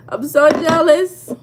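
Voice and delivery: high, loud, drawn-out mock envy; playful jealousy. Reacts to viewer's school news.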